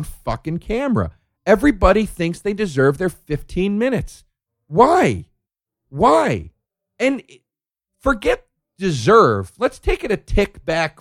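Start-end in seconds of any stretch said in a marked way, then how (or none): none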